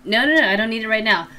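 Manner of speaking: normal talking voice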